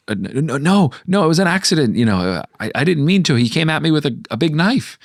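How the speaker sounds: mock-frightened babbling, voice jumping way up; panicked denial. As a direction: squeak out the excuse in a hammed-up panic. Hero panicking, denying he killed the Baron.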